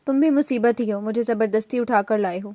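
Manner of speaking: ordinary delivery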